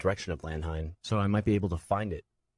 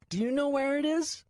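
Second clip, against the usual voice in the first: put-on tearful child. Nico dropped off alone, high tearful child. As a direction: hold back tears as a scared boy. Boy asking.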